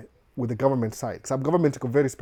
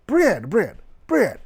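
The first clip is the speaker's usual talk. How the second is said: higher, louder mock customer hammering "bread"; insistent repeating customer; 7 semitones higher, 5 dB louder, much slower than usual. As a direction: imitate a customer repeating "bread" until understood. Customer insisting at the cashier counter.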